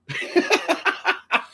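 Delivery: airy giggle up high; high breathy laugh. Real, not acted. Laughing at her own bad eyesight. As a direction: laugh it off lightly.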